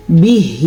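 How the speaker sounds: slow recited بِهِ; careful ha. Shown as a demonstration. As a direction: stretch the end of بِهِ. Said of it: "ہا کو دھیان سے دیکھیں".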